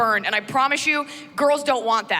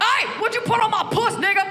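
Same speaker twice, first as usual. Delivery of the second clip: higher angry street yell; subtitle voice; 10 semitones higher than usual. Retold or imitated. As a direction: shout it like the real meaning. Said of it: "there's subtitles".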